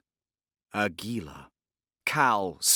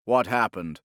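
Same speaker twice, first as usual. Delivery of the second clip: demanding; 6 dB louder than usual. A narrator's reading. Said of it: "as he demanded".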